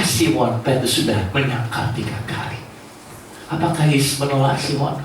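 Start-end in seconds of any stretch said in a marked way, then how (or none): none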